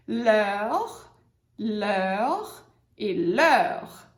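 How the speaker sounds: leur drawn out very slowly; teacher models leur slowly; French pronunciation. Shown as a demonstration. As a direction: model leur e leur, slow and stretched. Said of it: "essa outra palavrinha aqui que é o possessivo".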